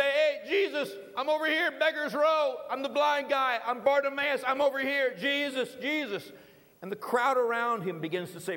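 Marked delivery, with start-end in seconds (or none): 0.0-6.3 high, raised voice hailing someone; calling out. Acted. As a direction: call across a crowd for attention. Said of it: "rise up a little bit and say".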